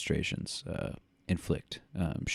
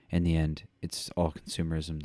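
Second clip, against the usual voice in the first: ordinary talk, nothing marked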